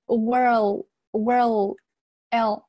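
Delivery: "world" drawn out with r, then a clear l; "world" stretched as wer-l, slowly; curled tongue. Shown as a demonstration. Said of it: "这里有一个卷舌".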